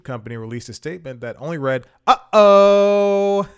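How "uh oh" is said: high, loud cartoonish uh-oh; mock corporate uh-oh. Comic host voicing the company's statement, high and loud. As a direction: squeal it like a guilty cartoon brand.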